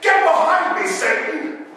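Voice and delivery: loud, high mock rebuke. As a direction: bark it like a prophet. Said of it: "I go to Norman and I say".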